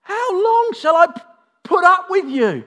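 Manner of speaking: high, loud exasperated yell; hammed-up frustrated outcry; frustrated shout. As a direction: bellow it as if patience has run out. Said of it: "Can you hear the frustration?".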